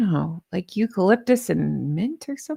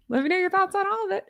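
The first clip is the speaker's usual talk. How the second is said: "thoughts" rushed out high and fast; fast, bright and higher; quick high sign-off; 8 semitones higher, faster than usual. Real, not acted. Rushing the sign-off to viewers, higher and faster. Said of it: "Again, with thoughts".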